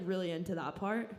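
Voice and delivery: ordinary talk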